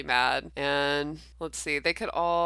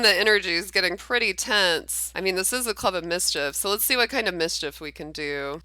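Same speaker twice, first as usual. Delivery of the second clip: ordinary delivery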